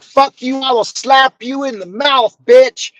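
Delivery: high-pitched imitation of an old woman threatening; mock furious threat. Mother-in-law threatening her daughter, high mock snarl.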